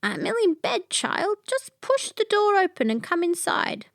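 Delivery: a dog faking a poorly grandmother; the disguised dog calls her in, playing a sick grandma; hoarse fake grandma. A narrator's reading. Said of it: "a little hoarse".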